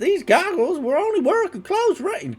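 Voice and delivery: high, slow goblin quest-giver voice. Pretend. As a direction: read it like a sneering goblin, drawn out. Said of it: "goblin voices".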